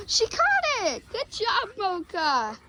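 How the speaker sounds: high delighted cry; thrilled praise for the dog; excited squeal. Real, not acted. Dog catches the ball.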